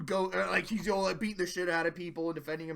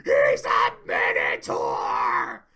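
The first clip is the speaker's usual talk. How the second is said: much higher, loud, slow shout; hammed-up outburst; 9 dB louder, slower than usual. Fluttershy defending Iron Will, a hammed-up high shout. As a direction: scream it indignantly. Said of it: "they're like".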